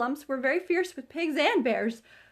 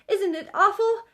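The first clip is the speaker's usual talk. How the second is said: frightened Piglet; 9 semitones higher, 6 dB louder than usual. A narrator's reading. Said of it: "said Piglet".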